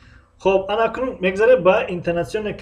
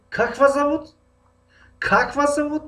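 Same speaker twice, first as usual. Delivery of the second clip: Как вас зовут with вас sung out much higher; emphatic re-ask; 9 semitones higher than usual. Acted. Teacher acting a listener who re-asks a name, emphatic. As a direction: press on вас, asking again in disbelief. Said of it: "барои таъкидӣ мо як бор дига савол мекунем".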